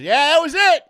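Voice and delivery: high, loud parking attendant's yell; excited shout. Retold or imitated. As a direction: shout it back, thrilled. Parking guy shouts the news.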